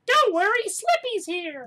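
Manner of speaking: very high squeaky frog voice; squeaky cartoon toad; Slippy voice. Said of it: "Yeah, Slippy Toad".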